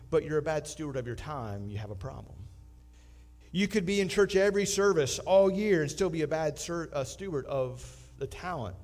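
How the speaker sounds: usual speaking voice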